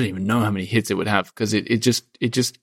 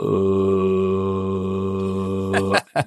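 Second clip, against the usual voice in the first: low sung monk-like hum; 3 semitones lower than usual. Shown as a demonstration. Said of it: "sort of do this kind of noise".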